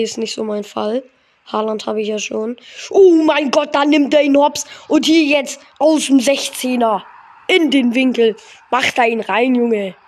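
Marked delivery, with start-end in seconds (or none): 2.9-10.0 excited. Real, not acted. Live reaction to a goal.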